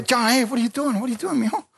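high-pitched, startled retold questioning; mock parental alarm, voice jumping up; alarmed father. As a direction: interrupt your kid in high-pitched alarm. Father interrupts cursing son in story.

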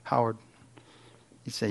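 ordinary talk, nothing marked

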